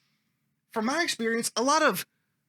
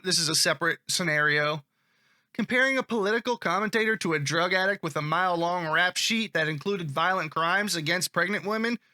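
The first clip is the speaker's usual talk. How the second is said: ordinary delivery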